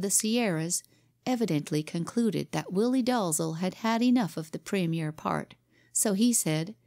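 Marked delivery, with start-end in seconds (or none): none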